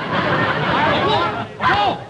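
loud, high, eager guesses; excited guess. Real, not acted. Teammate shouting guesses at the mime, excited. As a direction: blurt it out like you've cracked it.

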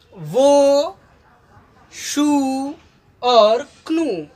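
teacher sounding out English words slowly; English words in Hindi lesson. Shown as a demonstration. Hindi teacher reads shoe, canoe, hoe aloud. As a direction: spell out each English word for students. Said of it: "हो means होता है कुदाली".

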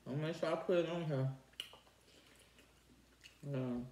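chewing between words; eating while talking; mouth full of food. Real, not acted. Seen 4: holds dumpling, jaw working, cheeks full while speaking.

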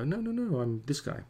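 normal talking voice

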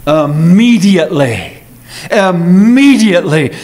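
"Immediately" stretched with a strong swell on the second syllable; emphatic IMMEDIATELY. Shown as a demonstration. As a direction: lean hard on "Immediately", drawing it out. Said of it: "This is emphasized".

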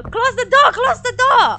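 high, loud frantic command; panicked yell. Real, not acted. Panicking at game threat.

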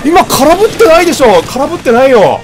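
loud, high outraged insistence; indignant protest. Real, not acted. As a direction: protest it loudly, you didn't miss. Protesting a missed hit.

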